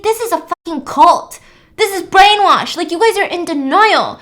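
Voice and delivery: loud, high accusing outburst; angry shouting. Retold or imitated. Will rails at the guests about a cult, loud accusing yell. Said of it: "he starts screaming at Eden".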